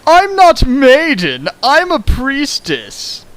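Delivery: high, loud and very slow, squeaky protest; mock-indignant Reimu. Reimu correcting Sato, hammed-up high protest. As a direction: squeal it like an offended girl.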